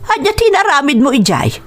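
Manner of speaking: high-pitched reproachful question; scolding. Acted. Mother confronts son about going to Maya's, scolding. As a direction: demand an explanation from your son.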